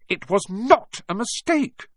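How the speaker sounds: slow, high, emphatic contradiction; angry. A narrator's reading. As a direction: insist indignantly word by word. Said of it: "said Harry angrily".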